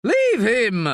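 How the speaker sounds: a son yelling to shield his father; sharp high shout to stop it. Acted. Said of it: "shouts Manolo".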